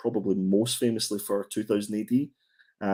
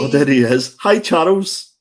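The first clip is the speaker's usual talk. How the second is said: loud, bright hello, pitched higher; loud greeting; 3 semitones higher, 10 dB louder than usual. Real, not acted. Host calls out loudly to the boss joining the stream. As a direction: call out a big hello as he joins.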